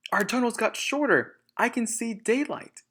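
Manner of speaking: high, worried little fox; alarmed child voice. Acted. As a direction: point it out in alarm.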